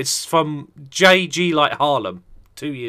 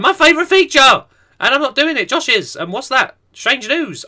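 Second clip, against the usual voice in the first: excited intro; 9 semitones higher, 4 dB louder than usual. Real, not acted. Hyped segment introduction.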